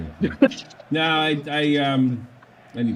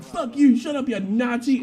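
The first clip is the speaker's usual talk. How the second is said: high, louder mock snapping back; retold angry retort; 14 semitones higher, 4 dB louder, slower than usual. Sergeant yelling back at the German driver, angry. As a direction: hurl the insult right back.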